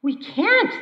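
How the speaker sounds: men shouting back at the voice; shouted back. Retold or imitated. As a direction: yell back in protest. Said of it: "they shouted back".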